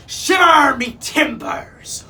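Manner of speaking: loud, drawn-out high roar; roaring pirate captain. A narrator's reading. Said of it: "roared Captain Snatchit".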